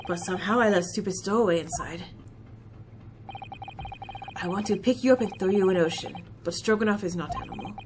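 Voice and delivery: put-on a proud Russian crewman, heavy accent; Russian seaman introducing himself, low and gruff; Stroganoff, Russian accent.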